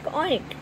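girl covering the kitten, mock oinking; mock pig oink. A narrator's reading. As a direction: grunt like a pig for fun. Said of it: "quickly followed by oink".

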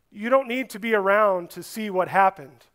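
ordinary talk

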